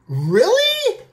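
shocked high "Really?"; surprised. Real, not acted. Reacting to partner's "meh.

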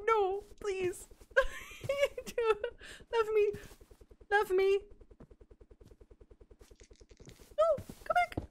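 very high, half-laughing, half-crying plea; desperate high pleading. Real, not acted. She pleads with the ladybug to come back, very high and laughing. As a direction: beg it not to leave, squeaking.

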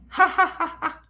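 high mock laugh; acted laugh. Teacher showing the story's laugh, light mock laughter. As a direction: act out the ha ha for the class.

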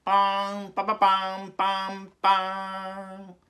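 high, loud vocal trumpet blast ending drawn out; mouth fanfare. Acted. Opening the athletes' oath with a loud high mouth fanfare. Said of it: "またファンファーレが鳴って".

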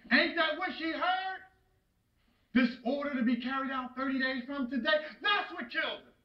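anguished blame. Acted. Acted father blaming son for death.

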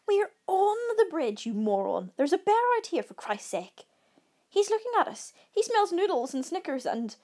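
high-pitched, exasperated alarm; panicked outburst. A narrator's reading. Camper berating friend with bear nearby, panicked. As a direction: voice leaping high on each fresh complaint.

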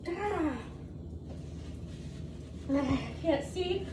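quiet whimpering. Real, not acted. Burning spice, whimpering.

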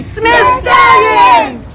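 loud slow shouted cheer; team cheer shout. Real, not acted. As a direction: yell the school name. Group cheer on three.

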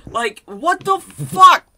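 high, loud, drawn-out disbelief; exasperated outburst. Real, not acted. Player ranting about a recycled gun, exasperated outburst. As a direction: let it burst out slowly, building to a yell.

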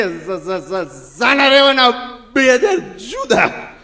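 mock crying stammer. Crying mourner stutters demand.

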